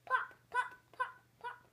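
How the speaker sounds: high, loud cork pops; popping cork. Acted. Reading to a child, loud high cork pops. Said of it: "He can sound like a cork".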